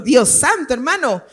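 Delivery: normal talking voice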